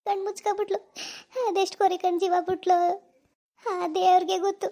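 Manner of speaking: usual speaking voice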